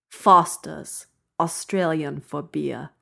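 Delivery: slow, drawn-out Australian accent; deliberately put-on Australian accent, stretched out. Shown as a demonstration. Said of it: "with an Australian accent".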